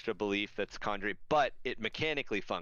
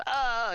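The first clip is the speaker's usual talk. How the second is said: loud, high imitated dying scream; hammed-up falling yell; mock orc death cry; 7 dB louder than usual. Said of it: "and the orc goes".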